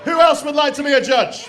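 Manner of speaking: rally the room for a volunteer; calling out. Real, not acted. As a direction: MC shouting for volunteers. Host calls out to the crowd.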